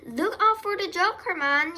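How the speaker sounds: high yell held up to the end; yelled warning. A narrator's reading. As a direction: yell a warning across the yard. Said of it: "yelled the gander".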